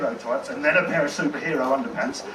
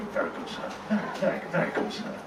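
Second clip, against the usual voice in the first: soft, clipped butler murmur; dry butler; 7 dB quieter than usual. Acted.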